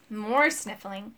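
usual speaking voice

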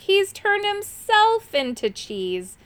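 high, slow wail of dismay; high-pitched moaning complaint; groaned. A narrator's reading. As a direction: draw it out in exasperation. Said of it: "groaned Irk".

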